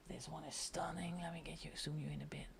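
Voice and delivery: soft whispered aside; whispering. Real, not acted. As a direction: whisper it to yourself. Aside while zooming camera.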